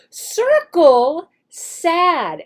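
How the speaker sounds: slow, clear classroom direction; slow instruction. Shown as a demonstration. Teacher demonstrates the spoken prompt, slow. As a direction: stretch it out for the child. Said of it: "While you say circle sad".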